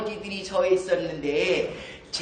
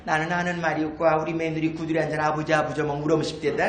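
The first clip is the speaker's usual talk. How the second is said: low, quick Jeju dialect reply; Jeju grandmother dialect; 5 semitones lower, faster than usual. Retold or imitated. Grandmother replying to her friend, quick Jeju dialect. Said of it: "할망 둘이가 막 검질 매다가 합니다".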